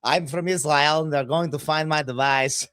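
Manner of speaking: high-pitched mock Israeli accent; hammed-up Israeli accent. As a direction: introduce yourself in a put-on Israeli accent. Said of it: "I said it in the Israel accent".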